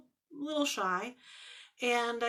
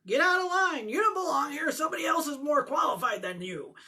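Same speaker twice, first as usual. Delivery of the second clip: imagined rejecting voice; 5 semitones higher, 3 dB louder, faster than usual. Retold or imitated.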